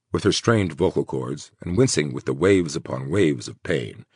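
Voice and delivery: ordinary delivery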